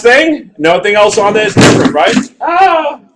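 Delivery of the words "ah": 1 sudden exclamation. Real, not acted. Sudden exclamation mid lesson.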